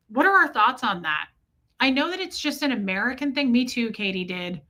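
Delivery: usual speaking voice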